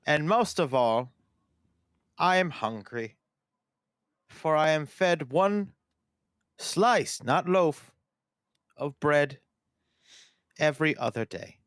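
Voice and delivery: slow, halting robot with long pauses; sad robot. Pretend. Co-host tells listeners they are held hungry, as a sad robot. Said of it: "You sound like a sad robot".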